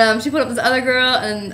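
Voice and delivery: normal talking voice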